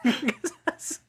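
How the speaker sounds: laughing as she speaks. Real, not acted. Host laughing recalling the song she paused, laughing. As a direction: giggle through the line.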